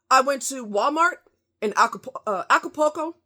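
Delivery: ordinary talk, nothing marked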